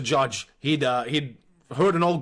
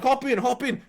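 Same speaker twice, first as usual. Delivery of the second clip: urgent stepdad; 9 semitones higher than usual. Retold or imitated. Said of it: "And then John's like".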